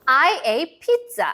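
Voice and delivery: very slow, carefully spaced model reading; slow model phrase. Shown as a demonstration. Teacher reading slowly for children to repeat after. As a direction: say it slowly so the kids can repeat. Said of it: "선생님이 말하면 친구들이 따라 읽을 수 있겠죠?".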